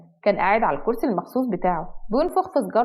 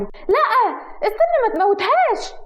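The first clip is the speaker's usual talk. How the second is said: much higher, loud shrieking plea; 11 semitones higher, 4 dB louder than usual. Acted. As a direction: shriek to stop him killing the pet. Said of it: "صوت ضحى العالي".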